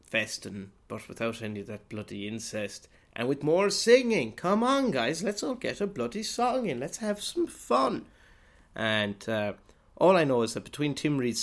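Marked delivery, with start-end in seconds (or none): 3.1-8.1 high, loud rallying cry for everyone to sing; bursting with fake enthusiasm, urging everyone to sing along; mock cheerful singalong push. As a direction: gee up the room like a hammy party host. Mock pushy singalong leader.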